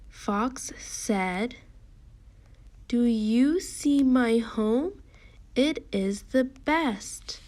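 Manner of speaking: slow storybook reading that lifts in pitch; drawn-out, projected teacher reading; slow read-along. Shown as a demonstration. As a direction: read slowly and clearly for children to join in.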